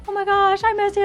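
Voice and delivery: high, loud, delighted squeal; overjoyed to hear an old friend; gushing reunion. Retold or imitated. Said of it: "And I'm like".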